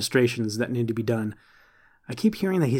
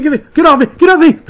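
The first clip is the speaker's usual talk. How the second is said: shouting; 12 dB louder, much faster than usual. Acted. Mock fight, shoved during the run.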